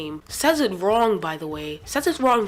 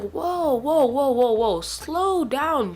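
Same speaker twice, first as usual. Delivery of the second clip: higher, startled string of whoas; taken-aback protest; 5 semitones higher, 3 dB louder, much slower than usual. Retold or imitated. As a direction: try to halt someone's outburst. Reacts to the teacher's outburst.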